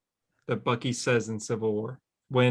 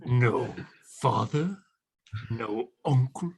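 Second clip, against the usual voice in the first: lizard voice; 3 semitones lower, slower than usual. Acted. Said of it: "do your best lizard impression".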